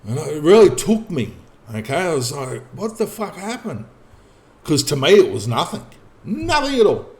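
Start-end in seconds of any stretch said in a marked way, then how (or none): 2.7-3.8 high, hushed retold disbelief; baffled reaction. Man recalls being caught off guard by officer's question, baffled. 6.2-7.1 very high-pitched dismissal; dismissive insistence. Real, not acted. Man tells listener it was nothing to him, high dismissive insistence.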